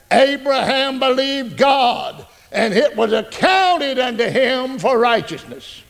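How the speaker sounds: very slow, drawn-out verse reading; slow preacher recital of the verse; slow solemn recitation. Acted. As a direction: let each word of the scripture land.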